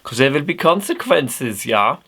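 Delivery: high mock-German warning; German accent. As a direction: warn in a put-on German accent. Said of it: "putting on a German accent".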